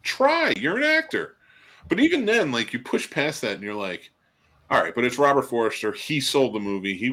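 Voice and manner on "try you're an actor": high-pitched exasperated appeal; exasperated plea. Retold or imitated. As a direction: plead with a hopeless actor in a raised voice. Exasperated mock plea to the actors.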